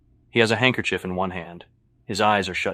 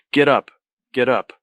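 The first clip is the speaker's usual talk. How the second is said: loud, higher repeated command; urgent order; 4 semitones higher, 7 dB louder than usual. A narrator's reading. Patrick urging Luis off the floor, urgent order. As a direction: order it sharply, twice.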